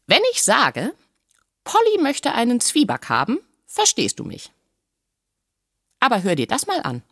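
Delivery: high, lilting parrot voice; Polynesia the parrot. A narrator's reading. Parrot demonstrates her languages to the doctor, knowing. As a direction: lecture him as a high-pitched parrot.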